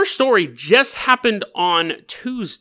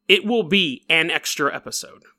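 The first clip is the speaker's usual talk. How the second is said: ordinary delivery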